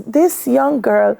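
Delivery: ordinary talk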